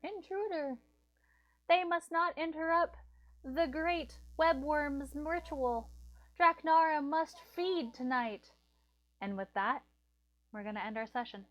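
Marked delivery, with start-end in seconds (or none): 0.0-0.8 high cry falling on each repeat; mock screaming alarm. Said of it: "hear them all now screaming". 1.7-8.5 high, loud, slow proclamation; screaming cultists. Acted. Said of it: "hear them all now screaming".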